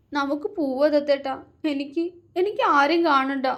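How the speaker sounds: put-on girl pleading to leave, voice breaking. A narrator's reading. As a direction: let the voice crack on each repeat. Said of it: "ഇടർച്ചയോടെ പറഞ്ഞു".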